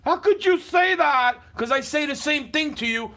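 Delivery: loud, heated yelling at her; furious yell at the neighbor; angry shouting. Real, not acted. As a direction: shout it out in real anger. Angry rant at neighbor on camera.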